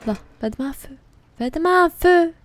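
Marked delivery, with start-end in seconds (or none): 1.4-2.3 impatient shout. Real, not acted. Urging character, repeated louder.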